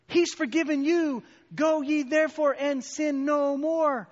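high, emphatic sermon delivery; forceful preaching. Retold or imitated. Old teacher telling a student of grace, preaching forcefully.

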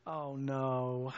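low, soft, drawn-out dismay; guilty dread. Retold or imitated. Driving home alone with guilt, quietly dismayed. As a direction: sink into a guilty "oh no". Guilty groan on the lonely drive.

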